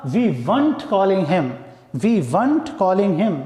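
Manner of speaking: "weren't" said slowly as one syllable; slow careful demo. Shown as a demonstration. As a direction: dictate it word by word. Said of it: "contraction form क्या होगा". (धीमे, साफ़ उच्चारण)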